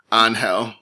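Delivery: Angel said the Spanish way, AHN-hel; Spanish pronunciation. Shown as a demonstration. Host correcting her co-host, Angel as Spanish AHN-hel. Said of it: "Don't, it's Angel".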